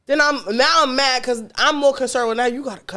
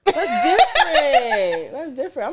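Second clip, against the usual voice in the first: laughing; 5 semitones higher, slower than usual. Real, not acted. Friends laughing at a bad date.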